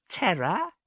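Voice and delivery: very high wondering question; awed amazement. A narrator's reading. Marast amazed to hear of Terra, high awed question. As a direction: gasp the word in awe.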